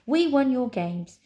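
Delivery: high, loud triumphant announcement; announced. Acted. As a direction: proclaim victory to the tower. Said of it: "Nine announced to the tower".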